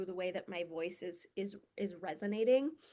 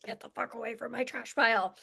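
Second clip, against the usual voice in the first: possum guarding trash; 5 dB louder than usual. Retold or imitated. Possum guarding its trash pile, snapping at intruders, loud. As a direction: snarl it like a cornered possum, louder. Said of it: "possum guarding your trash pile that's like".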